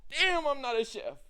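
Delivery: high, startled cry; mock dismay. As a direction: squeal at your own bad cooking. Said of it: "I was like".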